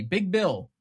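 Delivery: ordinary talk, nothing marked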